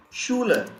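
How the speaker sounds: "Schuler" opening with a sh sound; "Schuler" said as SHOO-luh; German sch pronunciation. Shown as a demonstration. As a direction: model "Schuler" for learners, with sh at the start. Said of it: "we pronounce it as".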